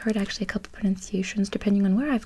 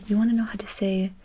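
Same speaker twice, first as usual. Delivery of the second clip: usual speaking voice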